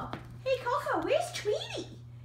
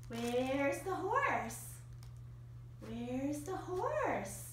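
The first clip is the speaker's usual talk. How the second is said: slow and low; 8 semitones lower, 8 dB quieter, much slower than usual. Real, not acted. Owner repeating cue to dog.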